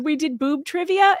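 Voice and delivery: ordinary talk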